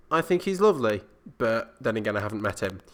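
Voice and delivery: usual speaking voice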